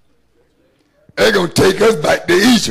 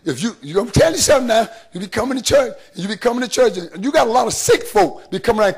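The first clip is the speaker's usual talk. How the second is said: normal talking voice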